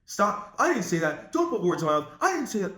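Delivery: mock flustered objection, climbing in pitch; defensive protest. Shown as a demonstration. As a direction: protest as if wrongly accused. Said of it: "You don't handle it by saying".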